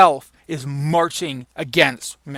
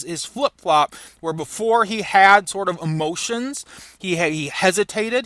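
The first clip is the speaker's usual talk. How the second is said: usual speaking voice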